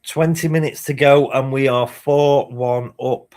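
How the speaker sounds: loud, high celebrating; crowing about the lead; excited. Real, not acted. Streamer telling chat the score, loud and excited.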